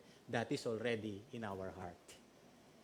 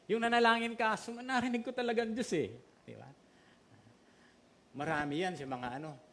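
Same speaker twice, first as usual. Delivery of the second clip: usual speaking voice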